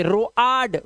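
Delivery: रो आड split in two, "ro-aad"; wrong pronunciation. Shown as a demonstration. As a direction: show the mistake, sounding both vowels in रो आड. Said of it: "ऐसा कुछ नहीं पढ़ते".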